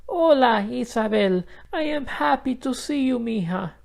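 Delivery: put-on high, gentle old lady greeting; kind grandmother. A narrator's reading. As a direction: greet her granddaughter gladly. Grandmother voiced, happy greeting.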